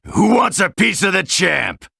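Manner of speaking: very high battle taunt, shouted. Acted. Champ daring opponents to take him on, taunting shout. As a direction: yell out a cocky challenge.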